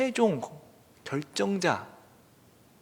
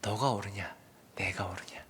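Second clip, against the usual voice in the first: low, hushed question; subdued, solemn challenge; quiet questioning; 8 semitones lower, 9 dB quieter than usual. Acted. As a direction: ask softly and low, as God to Jonah. Acts God questioning Jonah softly.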